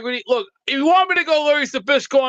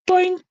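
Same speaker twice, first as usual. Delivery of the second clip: high boink sound; 9 semitones higher than usual. Pretend. Mock sound effect handing something.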